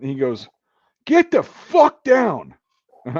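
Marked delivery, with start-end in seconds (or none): 1.0-2.5 slow, high, full-voiced command; imitated sergeant's yell; shouting drill sergeant. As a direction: bellow the order, stretching each word. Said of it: "my drill sergeant".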